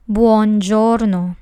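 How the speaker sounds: "buongiorno" said slowly and fully, bwon-JOR-no; careful Italian buongiorno. Shown as a demonstration. Teacher models buongiorno for learners, slow and careful. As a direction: model "buongiorno" with each syllable clear. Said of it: "how to pronounce these phrases correctly".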